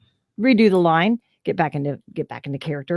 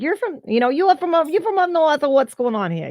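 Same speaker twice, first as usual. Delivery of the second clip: curious local; 10 semitones higher than usual. Retold or imitated. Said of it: "imitating someone noticing accents".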